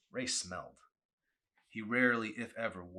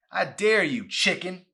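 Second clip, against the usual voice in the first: much higher, loud jeering dare; taunting shout; 12 semitones higher, 17 dB louder than usual. Acted. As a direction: yell the dare right in his face. Boy taunting a scared friend.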